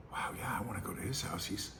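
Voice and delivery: hushed, starstruck whisper, quick then slowing; mock whisper. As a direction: whisper it like a dazzled young fan. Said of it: "and I'd go".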